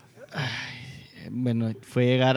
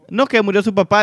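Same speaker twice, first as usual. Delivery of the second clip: high, loud blunt announcement of a death; just drop the news flatly, like a tactless grandmother; 8 semitones higher, 7 dB louder than usual. Retold or imitated. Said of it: "le dice mi abuela así". (la abuela suelta la noticia sin rodeos, aguda y fuerte)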